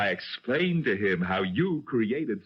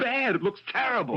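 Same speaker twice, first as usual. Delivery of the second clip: incredulous; 11 semitones higher than usual. Acted. Detective reacting to confession.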